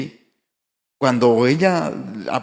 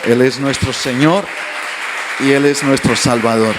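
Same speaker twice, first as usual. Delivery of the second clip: louder, firm proclamation; louder declaration; 7 dB louder than usual. Real, not acted. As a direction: declare it to the congregation. Sermon climax, declaring Christ.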